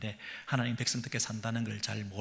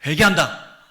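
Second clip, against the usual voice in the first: sudden loud emphasis; 6 semitones higher, 18 dB louder than usual. Acted. Preacher suddenly cries out the word 회개한다 loudly. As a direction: shout 회개한다 high and loud.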